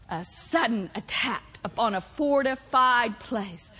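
low, soft, weighty repetition; hushed emphasis. Real, not acted. Preacher repeating what assault means, low hushed emphasis.

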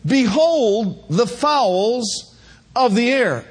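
slow, weighty repeat of the verse; drawn-out reading of holy writ; slow solemn verse; solemn scripture reading. Acted.

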